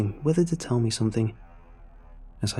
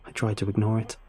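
usual speaking voice

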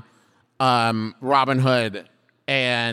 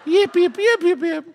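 high yappy fox; yappy chihuahua voice; 12 semitones higher than usual. Acted. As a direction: yip like a small dog. Fox answers in yips.

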